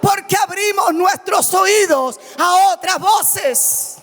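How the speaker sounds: full-force pulpit preaching; fiery preaching. Acted. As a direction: preach it to the whole church.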